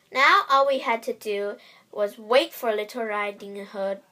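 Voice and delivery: usual speaking voice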